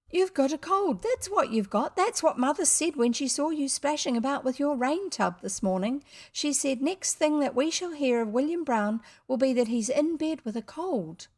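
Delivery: put-on high, chattering child; little girl. Girl repeating mother's verdict to boy, high-pitched. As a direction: tell him off knowingly, girlishly.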